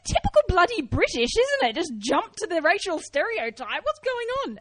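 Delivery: high, loud, hammed-up outrage; indignant outburst. Performer thrown out of character scolds an audience member, indignant outburst. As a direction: rant in mock disbelief, pitch shooting right up. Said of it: "it threw me, and I sort of dropped character".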